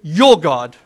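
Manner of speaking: "your" dropped low and pressed; a low, pointed "your God"; stress on your. Shown as a demonstration. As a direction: land firmly on "your". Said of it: "Not my God".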